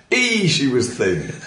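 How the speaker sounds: slow, drawn-out Yorkshire punchline; Yorkshire exclamation, played for laughs; hammy Yorkshire accent. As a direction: stretch the opening E in broad Yorkshire. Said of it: "my hilarious Yorkshire accent".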